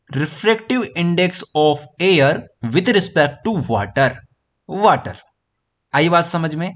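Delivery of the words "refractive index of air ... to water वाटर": "Refractive index of air with respect to water", stretched out very slowly; slow model pronunciation. Shown as a demonstration. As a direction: dictate it slowly to students. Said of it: "तो इसको आप लोग कैसे pronounce करोगे?".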